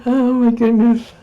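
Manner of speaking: giggling through the words; laughing. Real, not acted. Laughing at her own yawning.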